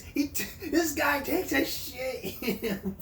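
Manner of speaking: words broken by laughter; real laughter while talking; laughing. Real, not acted. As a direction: laugh through the line. Laughing while describing clip.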